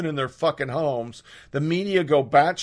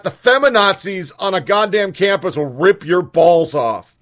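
loud, high furious rant; angry rant; 5 semitones higher, 9 dB louder than usual. Real, not acted. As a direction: yell it out in outrage. Ranting at opponents, getting louder.

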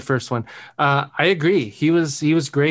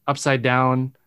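ordinary talk

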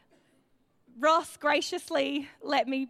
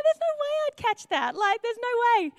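incredulous; 8 semitones higher than usual. Retold or imitated. Retold disbelief at being asked.

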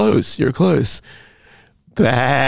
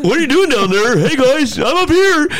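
much higher, louder giraffe calling down; 3 dB louder than usual. Pretend. Asked to repeat, giraffe hails the ground in high voice. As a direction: shout down from far above, squeaky and high. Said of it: "Wait, like what?".

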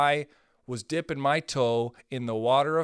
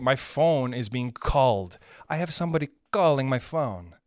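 ordinary talk, nothing marked

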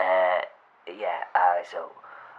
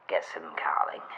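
soft unvoiced whisper, lower; whispered aside; 4 semitones lower, 11 dB quieter than usual. Telling Jerry on the phone about the beer, in a whisper. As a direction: let it slip out in a whisper.

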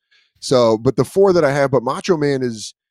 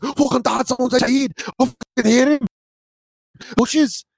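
much higher angry little dog; a high yappy terrier ready to fight; 14 semitones higher than usual. Retold or imitated. Terrier warning dad about stranger, high yappy voice. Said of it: "the voice".

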